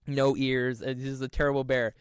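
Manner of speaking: usual speaking voice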